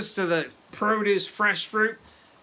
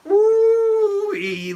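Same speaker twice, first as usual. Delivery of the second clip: high, loud whoop of delight; excited whoop; 9 dB louder than usual. Real, not acted. Thrilled streamer whooping at the mead.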